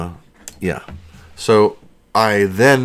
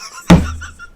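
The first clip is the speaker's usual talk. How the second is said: laugh; 5 dB louder than usual. Real, not acted. Laughing at own joke.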